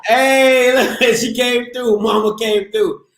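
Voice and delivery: high, laughing cheer for a guest's mom; excited cheer. Real, not acted. Host celebrating mom's message to the guest, excited cheer. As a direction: whoop it up, pitched high.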